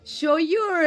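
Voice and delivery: ordinary delivery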